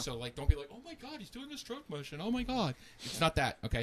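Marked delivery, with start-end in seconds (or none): 0.7-2.7 higher, hushed mocking gasp; a hint of a scandalised prude; mock shocked listener.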